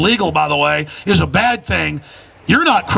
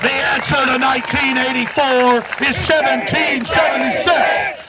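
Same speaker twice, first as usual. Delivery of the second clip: rallying shout; 2 semitones higher than usual. Real, not acted. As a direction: shout the slogan to the crowd. Chanted rally slogan.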